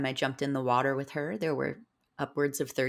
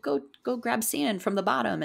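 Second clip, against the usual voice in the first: higher-pitched imitation of pushy friends; mock urging; 9 semitones higher than usual. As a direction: urge them on in a raised pitch. Friends egging her on, retold.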